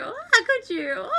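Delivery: high, drawn-out giggly protest; laughing reproach. Real, not acted. Streamer mock-scolding the chat, high and laughing.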